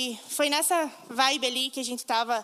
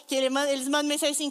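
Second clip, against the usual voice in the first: ordinary talk, nothing marked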